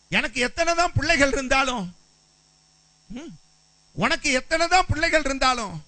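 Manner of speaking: high, loud husband begging his wife to stop crying; husband pleading loudly. Acted. Husband Elkanah consoling Hannah.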